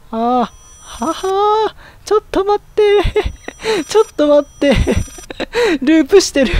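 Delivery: high-pitched words breaking into laughs; real frightened giggling, voice shooting up; panicked laughter. Real, not acted. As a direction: laugh helplessly while begging for a moment. Panicked laughing as game loops.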